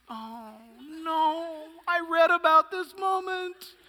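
a parent dreading the moment, hammed up. Parent facing first spanking, mock dread.

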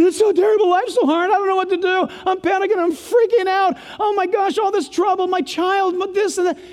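high-pitched, hammed-up fretting; mock panic. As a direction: imitate someone freaking out over their troubles. Acts out people panicking over troubles.

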